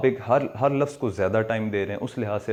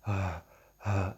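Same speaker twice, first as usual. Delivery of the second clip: tired breathy sigh; 3 semitones lower, 7 dB quieter, much slower than usual. Shown as a demonstration. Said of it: "تھک ہار کے آتے ہیں، بیٹھتے ہیں تو آپ کرتے ہیں".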